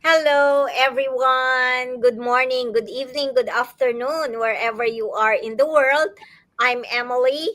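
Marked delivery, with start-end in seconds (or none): none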